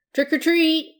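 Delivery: high, loud hopeful call. A narrator's reading. Cowboy at an opening door, hopeful. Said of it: "he asked hopefully".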